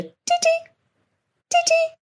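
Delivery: high piping double whistle; imitated bird call. Hearing the call all over the place, whistled imitation. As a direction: whistle the pardalote's two-note call. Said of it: "now I can hear the".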